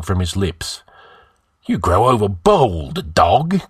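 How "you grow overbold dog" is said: growled. Acted. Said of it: "growled the outlaw".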